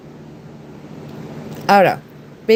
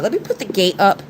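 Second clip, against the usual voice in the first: ordinary talk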